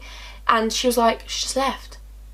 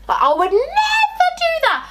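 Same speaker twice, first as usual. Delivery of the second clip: very high, drawn-out squealed protest; indignant squeal; 3 dB louder, slower than usual. Real, not acted. Friend appalled by a boy brought home, squealing indignantly. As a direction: shoot way up in pitch, stretching it out in outrage.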